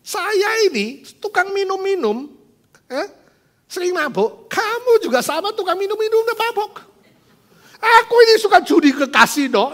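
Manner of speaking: high, loud exasperated rant; sick of hearing it, let it explode; husband fed up. Acted. Husband snapping at his wife's faith talk, high and loud. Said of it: "dia bilang".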